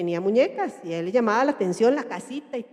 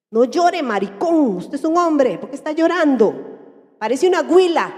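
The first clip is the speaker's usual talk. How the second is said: loud, higher-pitched acted telling-off; mock scolding; 4 semitones higher, 5 dB louder than usual. Parent berating a boy for crying, mock angry scolding.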